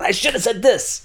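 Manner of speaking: hammed-up regret at the missed retort; staircase-wit whine, lightly played; George Costanza moment. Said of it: "a George Costanza moment".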